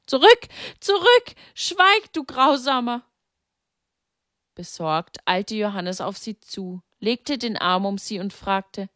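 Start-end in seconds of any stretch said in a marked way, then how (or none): 0.1-3.0 much higher, louder anguished outcry; cry out loud. A narrator's reading. Mary cries out at Satan, high anguished outcry. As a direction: shriek it at an unseen tormentor. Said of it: "Sie schrie laut auf".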